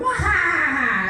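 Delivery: slow, hammed-up evil villain cackle; mustache twirling villain laugh. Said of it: "and then I went".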